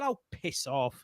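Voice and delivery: imitating himself calmly telling her to go away, slowly; calm dismissal. Walking out on the shouting manager: soft, slow, calm retort. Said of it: "calm as anything".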